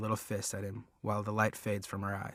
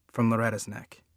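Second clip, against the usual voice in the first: plain reading voice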